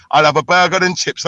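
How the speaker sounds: ordinary delivery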